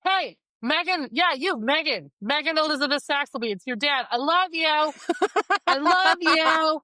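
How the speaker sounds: high, loud embarrassing dad shout; dad yelling from the car. Retold or imitated. Dad shouting his love at daughter from the car. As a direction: call her name across the schoolyard, full volume. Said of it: "start honking the horn as loud as he could and go".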